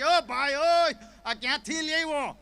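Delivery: high, loud outraged call; startled shout. Retold or imitated. Wedding host shouting at the crowd of mourners, startled shout. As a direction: yell at the intruders in shock.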